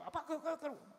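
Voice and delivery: high, quick, stammered nagging; agitated younger daughter. Retold or imitated. Second daughter warning dad about young people, agitated. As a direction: imitate a daughter scolding dad in a rush. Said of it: "막 그렇게 해요".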